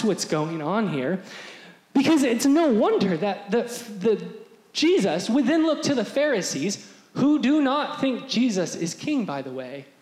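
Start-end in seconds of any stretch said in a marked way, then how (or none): none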